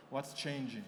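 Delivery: normal talking voice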